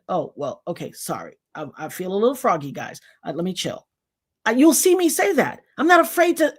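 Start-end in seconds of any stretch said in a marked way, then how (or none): 0.1-3.9 softer, lower, conceding; backing down gently. Retold or imitated. Quoting her apology to commenters, softly conceding. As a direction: ease off and apologize. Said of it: "and I said".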